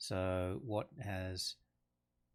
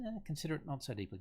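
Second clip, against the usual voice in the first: dismissive Lady Macbeth; 7 semitones higher, much faster than usual. Acted. Lady Macbeth brushing him off.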